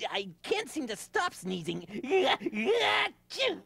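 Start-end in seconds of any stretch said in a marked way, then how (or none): 2.0-3.6 big hammed sneeze; loud fake sneeze. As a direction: explode into a sneeze. Sneezing monkey character.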